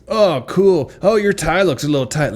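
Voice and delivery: high, bubbly barista voice; overeager barista. Retold or imitated. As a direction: gush like a too-friendly barista. Barista voiced in comedic bit.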